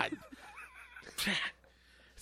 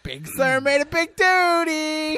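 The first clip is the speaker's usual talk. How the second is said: mock baby talk; 8 semitones higher, 4 dB louder, slower than usual. Baby talk about dog.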